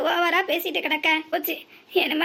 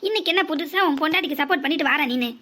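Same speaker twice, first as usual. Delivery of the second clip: ordinary talk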